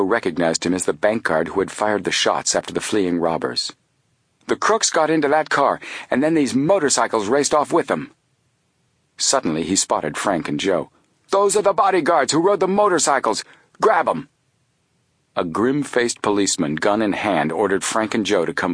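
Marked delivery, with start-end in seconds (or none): 4.5-8.1 high-pitched, agitated report; excited accusation. A narrator's reading. Bank guard to policemen, excitedly reporting. 11.3-14.3 high, agitated outcry; excited shouted accusation. A narrator's reading. Bank guard spotting the boys, shouting excitedly.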